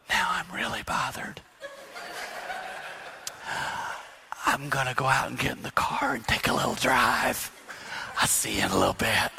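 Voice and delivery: hushed, restrained announcement with a laugh; soft voice. Retold or imitated. Husband telling wife he's taking a drive, soft. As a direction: calmly excuse yourself, keeping it quiet. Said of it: "Soft voice".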